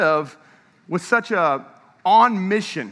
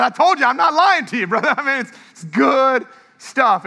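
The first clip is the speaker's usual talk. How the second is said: high, loud, laughing I-told-you; excited laughing boast; 6 semitones higher, 5 dB louder than usual. Retold or imitated. To a pastor friend over tacos, laughing and triumphant. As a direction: crow with delight through laughter.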